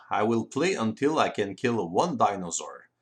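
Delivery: normal talking voice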